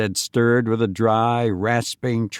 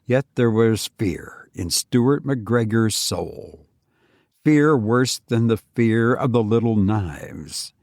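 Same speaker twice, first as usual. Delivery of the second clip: ordinary delivery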